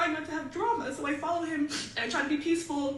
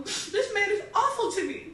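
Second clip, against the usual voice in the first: higher, slow, half laughing half crying; laughing through tears; 6 semitones higher, slower than usual. Real, not acted. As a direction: crack between a laugh and a sob. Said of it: "she gets very emotional".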